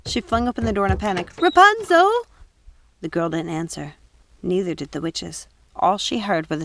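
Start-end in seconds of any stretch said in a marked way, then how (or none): 1.4-2.1 louder, higher call down the stairs; frantic call into the dark; panicked call. Acted. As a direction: shout into the cellar. Calling down cellar stairs.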